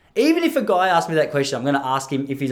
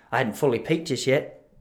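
ordinary talk, nothing marked

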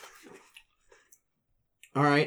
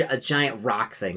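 ordinary talk

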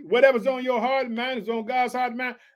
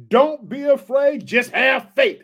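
slow, louder "afraid" and "faith" hammered; emphatic assurance; 3 semitones higher, 6 dB louder, slower than usual. Acted. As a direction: declare it slowly and boldly, pressing "afraid" down. Jesus reassuring grieving Jairus.

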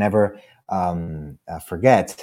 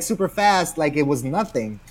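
ordinary talk, nothing marked

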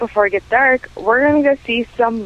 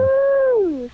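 excited whoop. Real, not acted. Caller cheering into voicemail.

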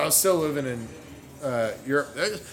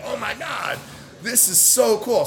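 thrilled; 8 semitones higher than usual. Retold or imitated. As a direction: gush with excitement. Thrilled at being first American.

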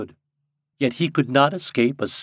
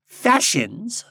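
lightly solemn, raised bird voice; solemn blackbird; 12 semitones higher, 4 dB louder than usual. A narrator's reading. Blackbird answering Popopo, solemnly. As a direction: pronounce it with measured weight. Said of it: "said a blackbird solemnly".

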